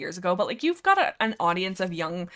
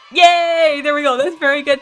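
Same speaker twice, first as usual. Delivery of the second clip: excited cheer; 7 semitones higher, 12 dB louder than usual. Real, not acted. Cheering a donation sound effect.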